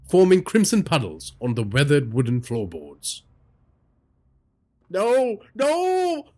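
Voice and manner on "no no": rising panicked no, very high; old man crying out in terror; terrified cry. Acted. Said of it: "the old man cried".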